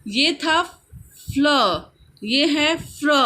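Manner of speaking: ordinary talk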